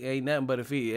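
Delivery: ordinary talk, nothing marked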